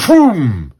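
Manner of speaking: loud, high boom, falling in pitch; big swooping boom; imitated boom. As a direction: punch out a comic-book explosion. Imitates comic sound effect.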